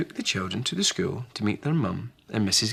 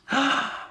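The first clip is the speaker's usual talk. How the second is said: gasp. Acted. Gasp in the story.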